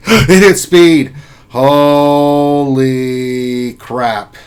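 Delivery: can't believe the roll landed; excited gasp at good luck; real surprised excitement. Real, not acted. Lucky roll result.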